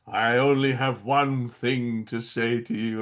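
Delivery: low, slow, heavy villain delivery; grave and dramatic, dragged out; big gravitas. Retold or imitated. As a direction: intone it like a solemn movie villain. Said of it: "really with big gravitas and everything".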